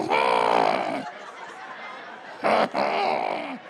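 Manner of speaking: long drawn-out mock wail; exaggerated wordless funeral moan; mock funeral noise. Comic imitating mourners at a funeral, mock wail. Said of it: "They be in there like".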